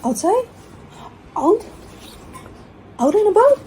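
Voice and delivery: high, bouncy teasing voice for the dog; high excited dog-talk. Pretend. Owner teasing her dog about going out, high excited voice. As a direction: wind the dog up with squeaky excitement. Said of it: "I'm messing with her".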